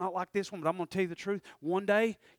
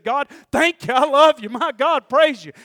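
shouted praise, much louder and higher; loud cry of thanks; 9 semitones higher, 18 dB louder than usual. Acted. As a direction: cry it out at the top of your voice. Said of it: "Came back at the loudest part of his voice".

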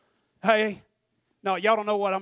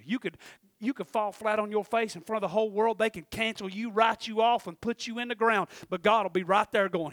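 normal talking voice

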